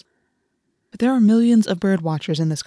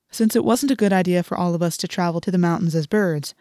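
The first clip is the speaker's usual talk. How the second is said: plain reading voice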